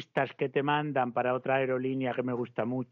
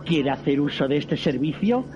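automated airline announcement; 6 dB louder than usual. Acted. Automated airline service pitch.

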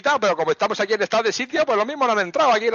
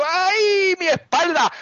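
mock complaint of back pain; 8 semitones higher, much slower than usual. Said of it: "y digas tú".

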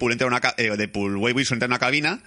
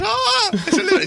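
squeaky, slow, high put-on voice; hammed-up high-pitched gasp; mock high reaction; 7 dB louder, much slower than usual. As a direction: drag it out in a silly falsetto.

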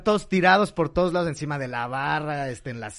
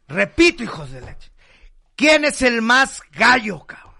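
put-on drunk challenge; 8 semitones higher, 7 dB louder than usual.

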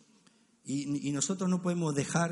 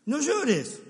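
dismissive husband; 7 semitones higher, 4 dB louder, slower than usual. Retold or imitated. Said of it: "Le digo".

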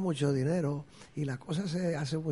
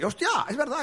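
pitched-up hammed "oh right"; mock realization; 12 semitones higher, 3 dB louder than usual. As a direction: play the sudden, cartoonish realization, high.

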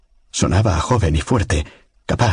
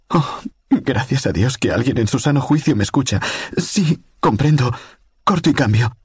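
a frightened woman's voice, higher and pleading; desperate woman on the radio; 8 semitones higher than usual. A narrator's reading. Lucy begs the stranger on the radio, frightened and pleading. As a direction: plead for rescue in a high, trembling voice.

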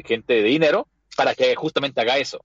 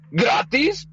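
incredulous; 11 semitones higher than usual. Retold or imitated. Villain doctor scoffs at free care.

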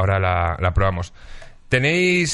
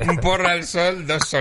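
laughing; 5 semitones higher than usual. Retold or imitated. Echoing the saying, laughing.

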